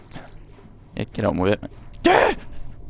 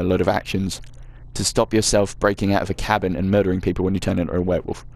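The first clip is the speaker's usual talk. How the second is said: ordinary talk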